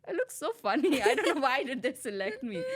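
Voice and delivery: high, loud, half-crying laugh; looking back at interview photos, laughing high; laughing at herself. Retold or imitated. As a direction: squeal in embarrassed disbelief. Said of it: "and I was like".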